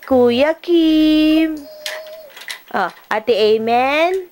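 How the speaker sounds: put-on slow, sing-song prompting of each name for the child to copy; coaxing a toddler. Parent coaxing toddler to greet each relative, sing-song prompting. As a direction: spell out each greeting so the little one can echo it.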